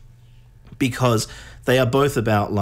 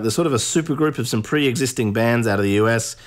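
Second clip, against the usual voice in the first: ordinary talk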